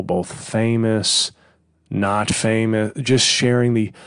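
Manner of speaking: ordinary talk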